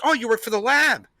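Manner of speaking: high-pitched retold interest; mock impressed surprise. Dog owner noticing his bags of samples, imitated surprise.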